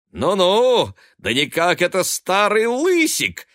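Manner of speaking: high, loud teasing greeting; exclaiming. A narrator's reading. Son shaking his brother's hand, exclaiming. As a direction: cry out in jovial surprise. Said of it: "воскликнул он".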